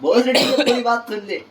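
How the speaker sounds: slow, high words through laughter; laughing, asking friends to listen; laughing plea. Real, not acted. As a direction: beg them to listen while laughing. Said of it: "अरे सुन तो ले".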